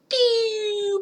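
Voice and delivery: falling crash noise. Retold or imitated. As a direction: whistle down like something crashing. Imitates crashing energy with a noise.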